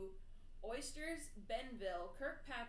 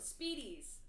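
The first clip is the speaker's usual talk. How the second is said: ordinary delivery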